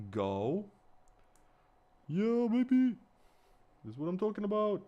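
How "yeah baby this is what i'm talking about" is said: high-pitched, whooping triumph; excited celebration. Real, not acted. Maker celebrating the finished backpack, high excited cheer.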